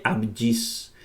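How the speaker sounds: "abjis" with the gamma as a Spanish Y sound; Greek word demonstration. Shown as a demonstration. Teacher reads the word out for learners, slow demonstration of "abjis". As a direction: say "abjis" slowly, gamma like the Y of yeso. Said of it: "se tiene que pronunciar como Y".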